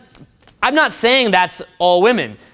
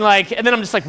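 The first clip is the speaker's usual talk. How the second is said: normal talking voice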